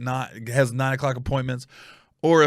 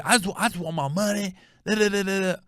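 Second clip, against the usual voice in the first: higher put-on whine; mock her complaint, tailing into babble; mimicked complaining woman; 6 semitones higher than usual. Woman telling the cop her story, mimicked whining.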